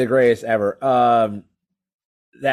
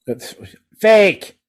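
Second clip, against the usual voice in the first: emphatic fake; 7 semitones higher, 4 dB louder than usual. Real, not acted. Arguing wrestling is fake.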